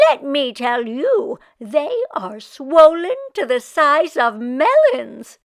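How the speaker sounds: put-on loud kvetching old woman wailing about her aches. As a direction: moan it out like a put-upon Yiddish grandma. Meshka complains to the baker.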